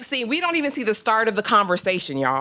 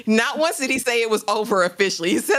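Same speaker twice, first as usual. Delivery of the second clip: ordinary talk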